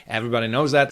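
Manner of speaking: ordinary talk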